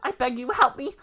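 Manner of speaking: a hint of a pleading moogle; pleading game character. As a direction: beg for help like a small creature. Reading NPC lines aloud.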